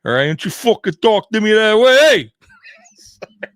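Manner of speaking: a hammed-up tough New Yorker telling someone off. Host imitating how New Yorkers talk, brash New York accent. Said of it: "like, New Yorkers".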